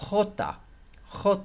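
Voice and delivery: "J" said HO-ta; "J" as HO-ta with a throaty H; Spanish letter name. Shown as a demonstration. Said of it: "that's how you say J in Spanish".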